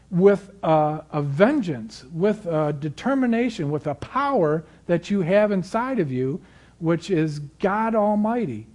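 rising, fervent preaching voice; stirring the congregation, climbing in pitch. Acted.

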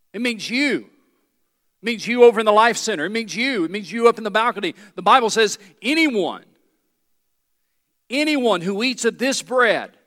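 loud, fast calling out to the balcony; preaching at full force. Real, not acted. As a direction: point at every listener. Pointing at congregation.

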